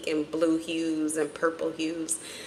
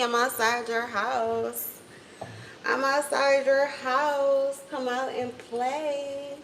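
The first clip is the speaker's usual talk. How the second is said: higher, slow creepy caller voice; heavy breathing phone whisper; 6 semitones higher, 3 dB quieter, slower than usual. Acted. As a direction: drag it out like a stalker on the phone. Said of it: "No, but for real".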